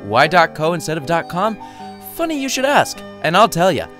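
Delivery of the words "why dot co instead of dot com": usual speaking voice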